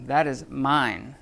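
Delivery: "mine" stretched out with emphasis; emphatic. Shown as a demonstration. Teacher showing students Greek emphasis, emphatic on "mine". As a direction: stress on mine, slow and drawn out. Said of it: "is for emphasis".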